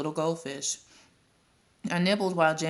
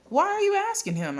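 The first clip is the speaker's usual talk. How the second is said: much higher, loud protest; indignant; 4 dB louder than usual. A narrator's reading. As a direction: object like an outraged kid.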